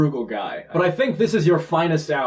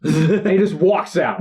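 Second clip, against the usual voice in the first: ordinary delivery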